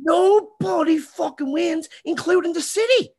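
fired-up rant voice; outraged outburst. Real, not acted. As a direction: blow up at the unfairness of it. Outraged agreement, city loses too.

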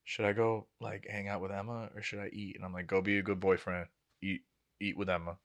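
low, very soft retold conversation; hushed exchange. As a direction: keep it down, like a discreet aside. Retelling hushed exchange with sister's boyfriend.

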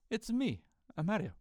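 high mock Italian accent; Mario. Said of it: "Like, the Mario says".

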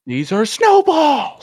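high, loud, drawn-out cry; hammed-up high shout; shouted quote. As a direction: holler it slowly like a famous movie line. Shouts out a quote mid-discussion.

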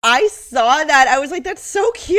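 high, loud gush of delight. Real, not acted. Cohost gushing about the father-son acting duo, high and excited. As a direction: squeal over how adorable it is.

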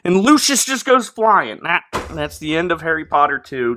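normal talking voice

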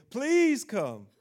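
high, loud desperate please come with a laugh; begging father. Retold or imitated. Preacher mimicking the official begging Jesus, high and loud. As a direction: beg like a man at his wits' end.

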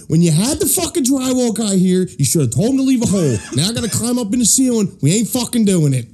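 high-pitched exasperated tirade; imitated angry rant. Boss refusing to cut a hole, imitated rant. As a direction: act out a fed-up refusal. Boss's ranting refusal, retold.

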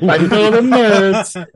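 high, slow posh tagline; hammed-up posh announcer. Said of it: "put on a posh voice".

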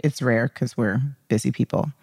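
ordinary delivery

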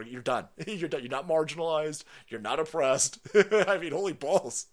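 ordinary talk, nothing marked

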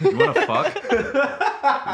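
very high, slow, laughing line; role play punchline. Pretend. Role play pickup at the bar, hammed-up crude line. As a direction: drag out the crude line as a joke. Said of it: "I'll be the dude".

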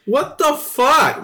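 slow, high-pitched disbelief; real astonished outburst; incredulous. Real, not acted. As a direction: stretch it out, pitching way up. Listener's shocked reaction to story.

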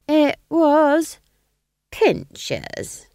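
put-on high, loud then dropping triumphant old witch; Nanny's voice. A narrator's reading. Nanny Og revealing her word, high then falling. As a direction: reveal the answer with relish. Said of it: "Nanny names her answer".

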